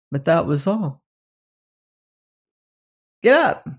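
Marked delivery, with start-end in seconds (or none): 3.2-3.7 raised, higher call to the horse; boy calls out again to his stubborn horse. A narrator's reading. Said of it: "called the boy again".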